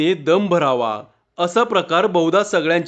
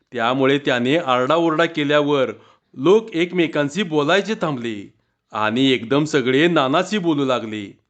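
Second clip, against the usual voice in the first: ordinary delivery, nothing marked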